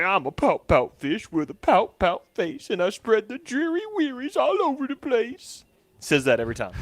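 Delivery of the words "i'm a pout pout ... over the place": slow, sulky rhyme rising much higher at the end; pout pout fish. Retold or imitated. Dad reading to his son, slow mopey fish chant. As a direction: drag out the gloomy refrain. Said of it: "and he's like".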